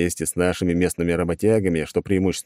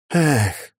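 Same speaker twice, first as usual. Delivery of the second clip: drawn-out, resigned sigh on a raised pitch; 6 semitones higher than usual. Acted. Hero giving up on the furniture request, sighing. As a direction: let the word out as a sigh. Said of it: "вздохнул я".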